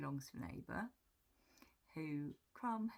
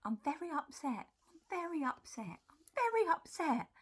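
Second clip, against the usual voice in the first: high, comic cat tantrum, louder each time; imitated upset shouting; 11 semitones higher, 8 dB louder than usual. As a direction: voice an outraged cat, building to a yell.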